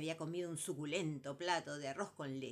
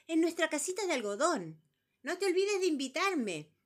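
loud, much higher eager voices; excited high voices; 11 semitones higher, 9 dB louder than usual. Acted. Number three and quiz bird calling out excitedly.

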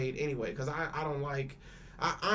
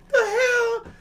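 much higher and louder, an incredulous yelp; shocked outburst; 10 dB louder than usual. Retold or imitated. Telling friends about the kid's steak, shocked and high. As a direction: hit it like you can't believe the order.

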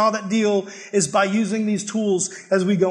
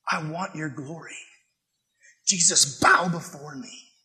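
Satan voice; 4 dB quieter than usual. Acted. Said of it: "we hear that in his voice".

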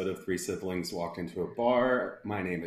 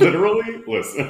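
Literally and listen squealed much higher and louder; mock emphatic catchphrase; 13 dB louder than usual. Shown as a demonstration. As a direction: ham up Literally, listen like the mentor. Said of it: "Like, literally".

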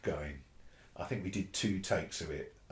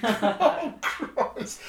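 much higher and louder, laughing oh; giddy laughing exclamation; laughing disbelief; 10 dB louder than usual. Real, not acted. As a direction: laugh it out, overwhelmed.

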